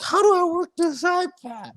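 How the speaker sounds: very high, squeaky caricature; imitated wife. Imitating Dickie's wife puzzled by an iPad, high caricature voice. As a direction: put on a silly high-pitched woman's voice. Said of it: "his wife's over here, and she's like".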